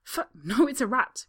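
loud, high, frightened cry; cries. Acted. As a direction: blurt it out in alarm. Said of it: "cries a woman".

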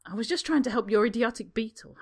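Harry snapping back at Draco in irritation; snaps. Acted. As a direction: bite the words off. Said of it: "Harry snaps".

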